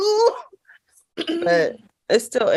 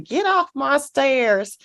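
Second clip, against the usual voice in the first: slow, drawn-out mock sleep talk, pitched up; sleep-talking child; 5 semitones higher, much slower than usual. Mom imitates son talking in his sleep, slow higher drawl. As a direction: imitate a kid ordering someone off in his sleep. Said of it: "he was talking in his sleep".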